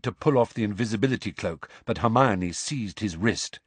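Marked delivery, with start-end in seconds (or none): none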